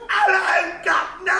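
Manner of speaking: put-on giggly send-up of a British accent; fake British accent. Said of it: "that fake-o British accent she suddenly has".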